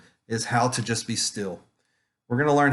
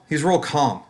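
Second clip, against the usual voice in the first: normal talking voice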